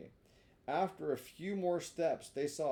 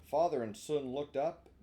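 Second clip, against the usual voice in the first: plain reading voice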